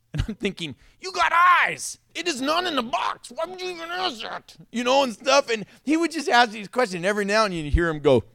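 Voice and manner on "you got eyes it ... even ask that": high-pitched mock outburst; acted incredulous irritation; exasperated inner retort. Acted exasperated thought at nosy neighbor.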